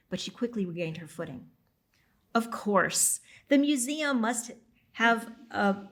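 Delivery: ordinary delivery, nothing marked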